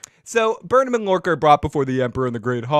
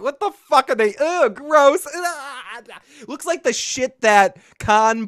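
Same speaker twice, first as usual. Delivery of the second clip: much higher, louder grossed-out groan; disgusted; 9 semitones higher, 3 dB louder, slower than usual. Real, not acted. Host reacts to the characters' meal, grossed out. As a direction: recoil from the food.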